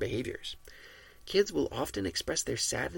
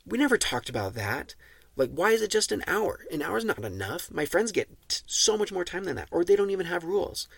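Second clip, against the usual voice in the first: put-on loud, higher whiny child protest; arguing kid; 7 semitones higher, 6 dB louder than usual. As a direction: argue back like a kid who wants more screen time. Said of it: "And your kid says".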